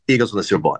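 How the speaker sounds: low, matter-of-fact retold answer; Bradley Cooper. Die-hard fan answering the radio host, low and decided. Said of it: "And he goes".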